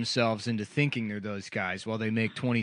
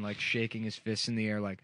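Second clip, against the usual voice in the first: ordinary talk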